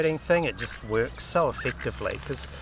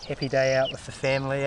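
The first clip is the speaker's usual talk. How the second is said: ordinary talk, nothing marked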